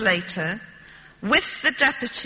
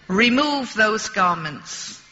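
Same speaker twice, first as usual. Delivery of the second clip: ordinary delivery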